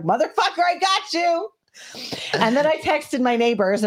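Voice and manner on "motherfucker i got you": high gleeful crow of victory; triumphant. Retold or imitated. Shooter gloats over the dead gator, high and triumphant. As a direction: savor the kill, giddy and high. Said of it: "and I was like".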